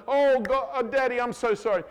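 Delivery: high, pleading mock child voice; hammed-up grovelling kid begging Dad's pardon; apologetic child. Child's overdone apology to father.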